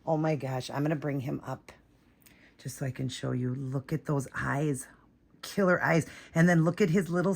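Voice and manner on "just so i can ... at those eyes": whisper. Real, not acted. Admiring the doll's eyes.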